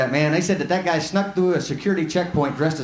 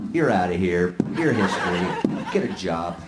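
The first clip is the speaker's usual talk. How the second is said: low-pitched, falling verdicts with pauses between; curt dismissal; 10 semitones lower, slower than usual. Acted. Voter in the booth crossing out politicians, low curt dismissal. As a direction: play the voter coolly firing them.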